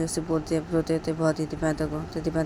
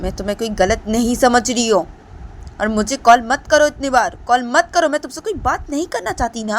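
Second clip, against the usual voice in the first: put-on higher, louder angry girl; angry scolding; 4 semitones higher, 8 dB louder than usual. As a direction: tell him off and hang up. Deepanta angry again.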